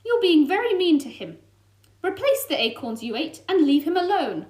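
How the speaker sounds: angry demand. Acted. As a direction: order the fox to stop. Frosty confronting the fox.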